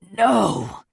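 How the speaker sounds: a loud, high bellow of anguish. A narrator's reading. Quincy bellows at the returned letter, loud anguished cry. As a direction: bellow it out in despair. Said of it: "he bellowed".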